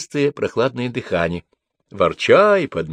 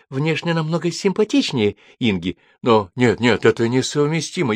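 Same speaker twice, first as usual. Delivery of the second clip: ordinary delivery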